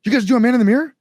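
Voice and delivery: high excited mock fan voice; quoting a fan. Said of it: "He's like".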